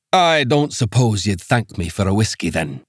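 lightly voiced Scots accent, dry; a Scots official offering whiskey; Scots magistrate. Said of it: "The accent was thick Scots".

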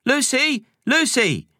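calling out high across the house; yelling. Acted. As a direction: shout for a friend at the door.

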